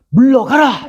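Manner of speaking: high ringing order; proclaiming command. Retold or imitated. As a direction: order Satan back with authority. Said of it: "이렇게 선포하시면서". (높고 힘찬 명령조로)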